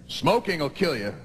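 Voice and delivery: ordinary talk, nothing marked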